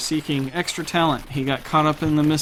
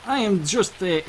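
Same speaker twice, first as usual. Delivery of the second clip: higher, hesitant attempt at a German accent; 6 semitones higher, 4 dB quieter than usual. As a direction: try a stronger German accent, haltingly. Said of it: "you hear his, his accent come out a lot stronger".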